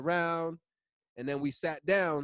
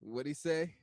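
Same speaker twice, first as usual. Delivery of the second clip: ordinary talk, nothing marked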